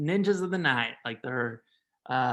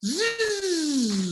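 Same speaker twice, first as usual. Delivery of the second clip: electric buzz; 12 semitones higher than usual. Acted. Repeats his buzz sound.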